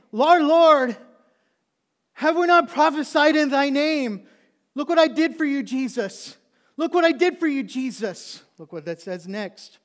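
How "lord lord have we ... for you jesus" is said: screamed, high-pitched begging; full-force, drawn-out wail of protest; desperate pleading shout. Acted. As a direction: cry out frantically to be spared. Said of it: "And they're saying".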